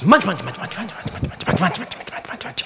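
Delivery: munching that fades into a whisper; munching noises. A narrator's reading. Lamb lost in another clover patch, whispered munching. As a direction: chomp rapidly, trailing away to bare breath. Storyteller mimics lamb eating.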